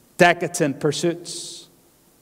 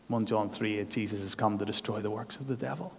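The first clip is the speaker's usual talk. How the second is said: usual speaking voice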